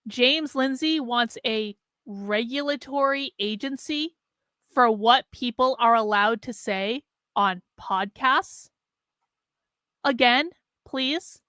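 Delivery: slow, halting question rising at the end; incredulous questioning. Real, not acted. Host questions James Lindsay's agency idea, slowly incredulous. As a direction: measure it out in disbelief.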